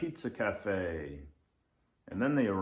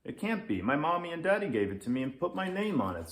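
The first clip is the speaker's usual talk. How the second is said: put-on higher, fast childlike chatter; little kid; 7 semitones higher, much faster than usual. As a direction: rattle it off like a proud kid. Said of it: "says Leo".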